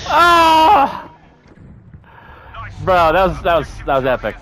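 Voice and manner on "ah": man down shout. Real, not acted. Player goes down in fight.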